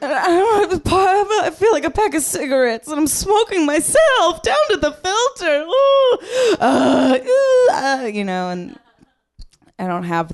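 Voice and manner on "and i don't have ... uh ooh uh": very high, loud hammed-up wailing sob; melodramatic sobbing woman. Comic parodying a sober woman's doorstep breakdown, hammed-up wail. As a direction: overact a tragic rock-bottom breakdown. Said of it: "you're supposed to have, like".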